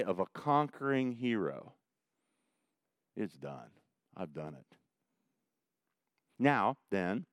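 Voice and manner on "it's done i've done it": soft triumphant quote. Acted. Quoting Jesus' victory cry.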